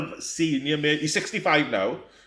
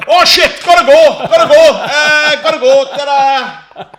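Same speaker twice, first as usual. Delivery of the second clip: high, loud squealing "gotta go" in a hammed-up panic; panicked escape; 13 semitones higher, 15 dB louder than usual. Van man caught out, squealing as he flees. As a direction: mimic a man bolting in embarrassment, shrill and loud.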